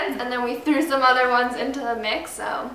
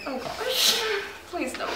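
usual speaking voice

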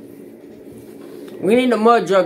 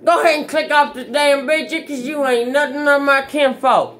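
raised voice; 6 semitones higher than usual. Real, not acted. Warning viewers off cigarettes.

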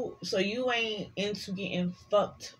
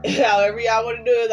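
laughing; 4 semitones higher, 9 dB louder, faster than usual. Real, not acted. Laughing aside to viewers.

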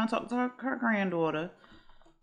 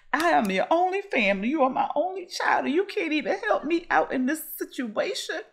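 higher, pleading mother voice; guilt-tripping grandma; 9 semitones higher than usual. Retold or imitated. Grandma guilt-trips her only child about money, higher and pleading. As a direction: lay the guilt on, pitched up.

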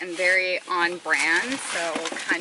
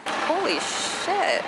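amazed exclamation; 9 semitones higher, 6 dB louder, much slower than usual. Real, not acted. Reacting to the fog outside.

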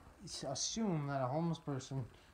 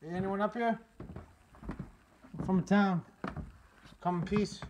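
loud, raised announcement to whoever's inside; real call-out, louder and higher; 6 semitones higher, 7 dB louder than usual. Real, not acted. Explorer hails whoever may live up there, calling out.

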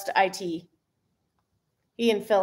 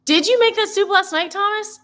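much higher, louder playful tease; teasing; 10 semitones higher, 5 dB louder than usual. Real, not acted. Teasing Thomas about the soup, high and playful. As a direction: poke fun at him.

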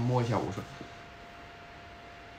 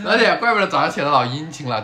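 louder, laughing through the words; laughing tease; 3 semitones higher, 8 dB louder than usual. Real, not acted. Cohost tells viewers about his attentiveness, laughing loudly. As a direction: tease him while cracking up. Repeats teasing line laughing.

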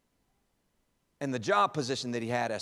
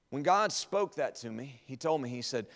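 usual speaking voice

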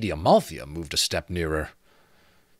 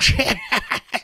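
high rattling skull cackle; 5 dB louder than usual. A narrator's reading. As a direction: let out a bony rattling laugh. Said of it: "The skull gave a rattling cackle".

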